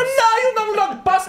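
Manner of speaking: high, loud, laughing complaint; exasperated outcry. Real, not acted. Telling friends the jeep overshot, crying out exasperated. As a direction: exclaim it high through a laugh.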